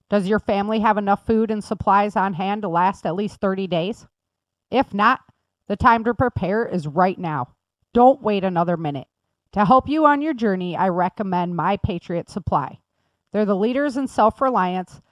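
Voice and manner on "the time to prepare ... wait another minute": ordinary talk, nothing marked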